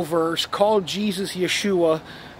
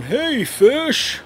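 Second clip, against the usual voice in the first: snarky mock greeting; 8 semitones higher than usual. Mocking the host who calls fans fish, snarky. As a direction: sneer "Hey, fish." like a smug host. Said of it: "he says it in this fucking snarky voice".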